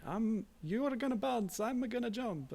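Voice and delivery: put-on broad Italian accent, pitched high; a hammed-up Italian plumber accent. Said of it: "you can see how broad the accent is in that".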